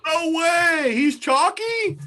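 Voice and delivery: high, drawn-out disbelief; shocked. Real, not acted. Host hears the bad pick is chalk, shocked. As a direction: exclaim it slowly, way up high.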